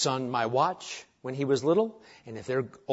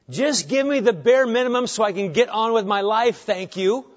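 higher, louder, brusque mock complaint; mock impatient churchgoer; 6 semitones higher, 4 dB louder than usual. Churchgoer wanting the bare minimum from the preacher, mock impatient.

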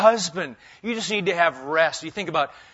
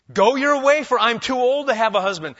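much louder, higher urging; Naomi urging; 7 semitones higher, 8 dB louder than usual. Retold or imitated. Naomi urging her daughters-in-law loudly to leave. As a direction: plead loudly, send them away. Naomi voiced, after plain reading.

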